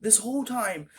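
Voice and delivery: high, drawn-out frustration; exasperated venting. Real, not acted. Telling viewers about days wasted over an email, exasperated.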